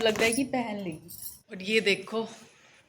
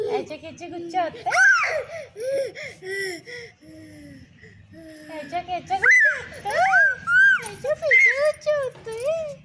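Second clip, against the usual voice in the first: much higher, louder mock scolding; hammed-up playful shout; mock angry shout; 14 semitones higher, 9 dB louder than usual. Real, not acted.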